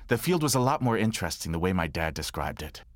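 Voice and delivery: normal talking voice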